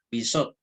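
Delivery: Bizot repeated as two syllables, no shva; demonstrated pronunciation. Shown as a demonstration. Teacher repeating for students, two-syllable Bizot. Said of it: "se va a pronunciar".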